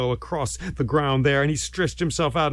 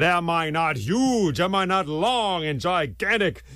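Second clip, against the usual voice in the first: higher, slow, grandiose python voice; boastful python; 5 semitones higher than usual. Acted. Python bound to the stick, boasting grandly. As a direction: stretch out the big words pompously.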